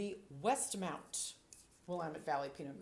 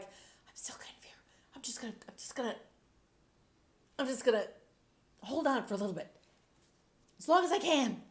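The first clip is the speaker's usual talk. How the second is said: personified finish voice; 6 semitones higher, 4 dB louder, faster than usual. Pretend.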